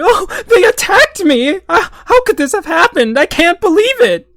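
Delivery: mock whiny victim. Mocking a provocateur playing the victim with a high fake wail. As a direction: wail like a shocked victim who brought it on himself. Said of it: "I'll beat up going".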